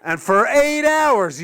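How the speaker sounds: ordinary talk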